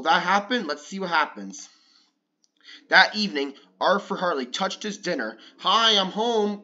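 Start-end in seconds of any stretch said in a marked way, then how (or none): 5.6-6.6 slow, louder call through the house. Acted. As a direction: call it from the front door. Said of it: "called Mom".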